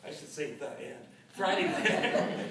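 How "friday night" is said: slow, laughing title announcement. Real, not acted. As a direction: laugh through the words.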